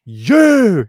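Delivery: loud, high "You!"; accusing shout. Retold or imitated. Heather blames Connor, loud accusing cry. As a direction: point and yell the blame.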